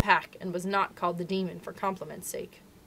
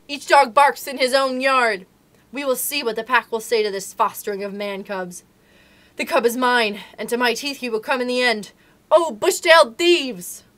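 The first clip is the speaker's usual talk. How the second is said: shouting; 4 semitones higher, 9 dB louder than usual. Acted. Tiger backing out of the cave shouting threats at the wolves. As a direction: hurl threats as he backs away. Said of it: "he shouted".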